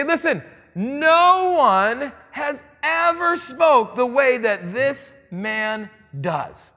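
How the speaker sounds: very slow, drawn out, each word weighed; slow awed emphasis. Retold or imitated. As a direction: spell out the awe of the guards word by word. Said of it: "they say".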